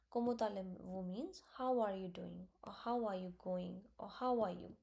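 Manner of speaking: ordinary delivery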